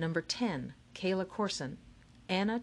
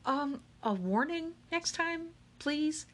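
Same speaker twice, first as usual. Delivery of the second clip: higher, slow, pained request for a warning; startled complaint; 10 semitones higher, slower than usual. A narrator's reading. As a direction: wince and ask for a heads-up. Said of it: "Akemi flinched".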